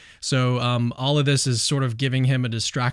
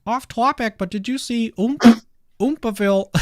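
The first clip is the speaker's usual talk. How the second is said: high-pitched, half-hearted impersonation; badly faked high voice; Jordan Peterson; 9 semitones higher, 4 dB louder than usual. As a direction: attempt a celebrity voice, pitched well up. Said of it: "I can't really do it".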